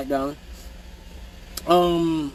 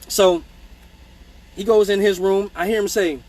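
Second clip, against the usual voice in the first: usual speaking voice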